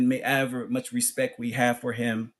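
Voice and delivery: ordinary delivery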